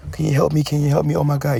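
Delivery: mocking a frantic woman calling for help; mock helpless woman. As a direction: ham up a woman begging for help.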